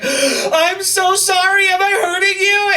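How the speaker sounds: very high, drawn-out mock squeal; panicked apology. Nervous first-timer apologising to a woman in bed, mock-panicked. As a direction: apologise in a hammed-up falsetto panic.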